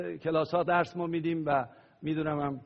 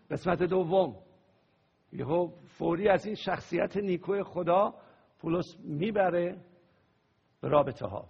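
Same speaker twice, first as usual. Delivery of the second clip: normal talking voice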